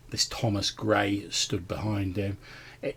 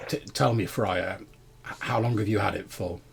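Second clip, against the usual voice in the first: usual speaking voice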